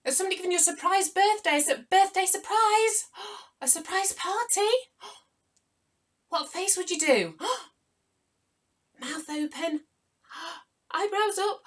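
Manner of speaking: high, loud, fast gasping excitement; mock surprise. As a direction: act out delighted shock with gasps. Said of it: "Surprised face".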